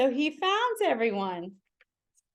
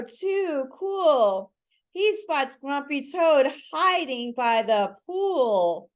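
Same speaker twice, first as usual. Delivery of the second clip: plain reading voice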